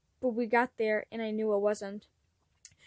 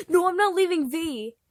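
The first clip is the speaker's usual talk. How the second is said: loud, higher, tearful defiance; yelled; 6 semitones higher, 8 dB louder than usual. Acted. Pranks refusing to leave his brother, yelling through tears. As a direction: refuse at the top of your voice. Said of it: "he yelled".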